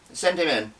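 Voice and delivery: high, loud barked command; barked order. Acted. Boss calling toward the doorway, barking loudly. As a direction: bark it at the door. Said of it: "Mother looked up towards the doorway and barked".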